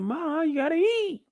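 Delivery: high, put-on imitation of Diana; squeaky mimic of the daughter; high mock girl voice. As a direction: pitch it way up like a pleading girl. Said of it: "She said".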